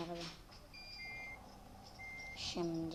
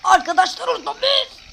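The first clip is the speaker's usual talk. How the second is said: high, loud excited call; excited shout; 12 semitones higher, 13 dB louder than usual. Real, not acted. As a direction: call out to them, thrilled.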